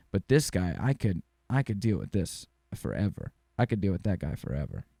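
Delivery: ordinary talk, nothing marked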